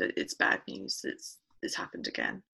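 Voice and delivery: whispered through tears, voice gone; a hushed, crying phone call; broken tearful whisper. Retold or imitated. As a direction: barely get the words out, choked up. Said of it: "I just didn't stop crying".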